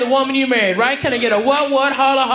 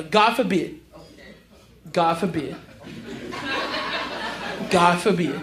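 mock solemn; 8 semitones lower, 6 dB quieter than usual. Mock solemn repeated phrase.

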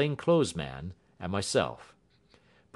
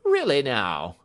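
loud, higher-pitched exclamation; raised, incredulous inspector voice; crying out; 6 semitones higher, 8 dB louder than usual. A narrator's reading. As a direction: cry out at the bold claim. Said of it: "cried the inspector".

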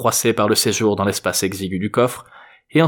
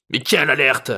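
higher-pitched grouchy retort; grumbling old man; 4 semitones higher than usual. A narrator's reading. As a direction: grouse it back at the gendarme. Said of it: "râla Papy Paul".

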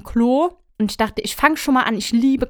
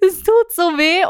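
retold wail; 8 semitones higher, 5 dB louder than usual. Retold child crying out pain.

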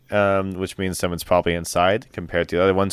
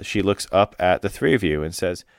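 ordinary delivery

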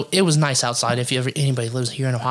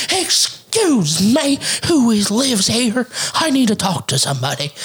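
high, stretched-out calling voice; imitated yelling; 8 semitones higher than usual. Neighbor lady demanding to talk to somebody, mock yelling. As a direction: mimic a demanding holler, easing toward the end. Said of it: "I hear somebody yelling".

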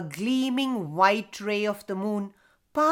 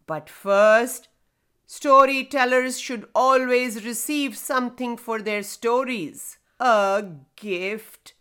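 grinding rumbling rock voice; 5 dB louder than usual. Acted. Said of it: "The stone introduced himself".

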